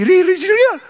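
high loud burst of joy; can't believe your luck; man at the bank finding the check is real, elated; thrilled disbelief. Acted.

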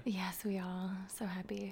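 soft, giddy whisper. Real, not acted. [name] whispers back her joy about the Oscar, hushed.

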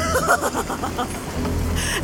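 high, breathy mad laughter; crazed high-pitched chuckle; manic giggling laugh. Acted. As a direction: laugh giddily after the shouting. Mad scientist cackles.

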